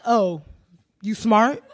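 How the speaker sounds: lightly mocking, condescending "Oh, you smart?"; a hint of talking down, with a small questioning rise; patronizing "you smart?". Director imitates the man belittling her, mildly patronizing. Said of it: "Like that".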